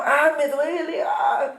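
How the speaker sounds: high, slow, hammed-up pained wail as the stoned martyr; mock cry of pain. Preacher mimics Esteban being stoned, a mock cry of pain. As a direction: act out Esteban crying out in pain, lightly mocking. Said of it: "estaba Esteban diciendo".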